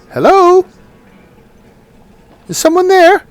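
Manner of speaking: tiny high voice calling out; a tiny sprite calls out, high; tiny tinkling snowflake sprite. Acted.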